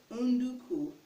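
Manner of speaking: "unduku" as oon-DOO-koo; Igbo pronunciation. Shown as a demonstration. Teacher gives the Igbo for potato, "unduku" as oon-DOO-koo. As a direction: say "unduku" with three "oo" vowels. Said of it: "which is pronounced".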